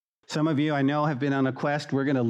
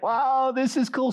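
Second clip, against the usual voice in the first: mock excitement; 9 semitones higher than usual. New church excitement imitated.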